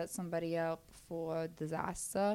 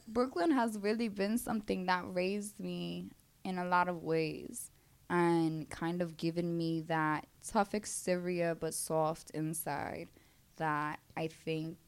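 ordinary delivery

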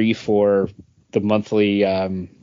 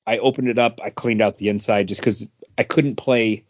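usual speaking voice

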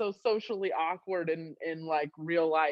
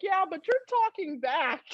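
put-on child's retort; 10 semitones higher than usual. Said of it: "And he's like".

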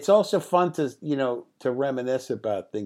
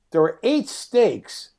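normal talking voice

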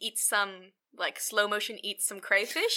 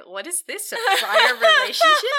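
laughing disbelief; 13 semitones higher, 10 dB louder than usual. Retold or imitated. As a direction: pitch jumps high and loud through a laugh. Narrator's own baffled reaction, laughing.

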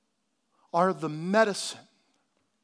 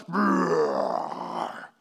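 a short growling brrr, slowed down; Hulk growl; 10 semitones lower than usual. Retold or imitated. Pastor mimics himself turning into the Hulk, growling brrr. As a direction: rumble out a brief Hulk-like growl. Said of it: "I turn into the Incredible Hulk".

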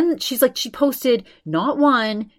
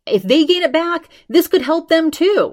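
higher and louder, pushing the point home; emphatic insistence; 9 semitones higher, 7 dB louder than usual. Retold or imitated. Taylor insisting to the doctor, higher and louder. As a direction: press it like a firm rebuttal.

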